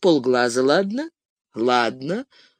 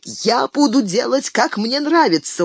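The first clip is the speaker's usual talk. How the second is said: stubborn; 9 semitones higher than usual. A narrator's reading. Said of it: "упрямо твердил Бильбо".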